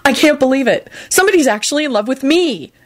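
high-pitched, thrilled inner thought; elated disbelief, pitched high; ecstatic. A narrator's reading. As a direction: marvel at it, overjoyed. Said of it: "Neville thought ecstatically".

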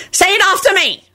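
high, shrill bossy voice; shrill command. A narrator's reading. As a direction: pipe the order out shrilly. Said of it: "Dorcas shrilled".

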